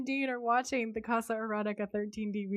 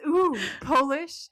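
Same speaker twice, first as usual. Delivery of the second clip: loud, slow, higher teasing coo; hammed-up flirty ooh; 5 semitones higher, 6 dB louder, slower than usual. Host voicing the porn actress eyeing the kielbasa, hammed-up. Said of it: "And she says".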